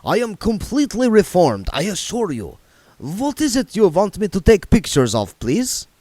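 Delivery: put-on eager, accented reassurance; Otto vampire accent. A narrator's reading. Otto promising William in an Eastern European accent. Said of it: "said Otto".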